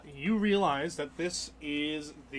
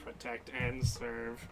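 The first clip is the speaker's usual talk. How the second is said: slow, low Protectron voice; understated slow robot; lightly voiced robot; 4 semitones lower, 8 dB quieter, much slower than usual. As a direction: intone it softly like a dutiful robot.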